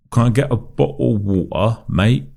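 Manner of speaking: Americans' teasing fake British voice; mock American Brit accent. Mimicking Americans teasing a Brit, in a put-on British accent. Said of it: "they're just adamant that they have to say".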